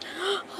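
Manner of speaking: sharp high gasp of fright; sudden frightened intake of breath; startled gasp. Real, not acted. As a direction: gasp as something jumps at you. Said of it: "that scared me".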